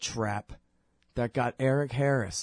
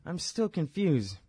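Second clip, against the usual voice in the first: put-on confused kid; 7 semitones higher, slower than usual. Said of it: "this kid came up to him and he said".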